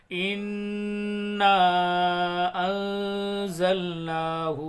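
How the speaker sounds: very slow, drawn-out chanted recitation; slow tajweed recitation; Quran recitation. Shown as a demonstration. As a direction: recite melodically, holding each word. Teacher recites verse slowly.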